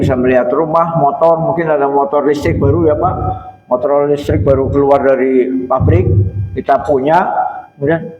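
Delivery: usual speaking voice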